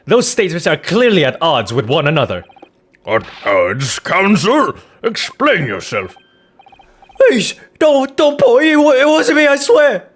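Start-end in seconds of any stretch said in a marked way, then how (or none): 3.1-6.2 mildly stern magistrate; lightly stern judge. Acted. Judge questions counsel. 7.2-10.1 much higher, louder frantic begging; high, loud terrified denial; panicked pleading. Acted. Frightened witness pleading.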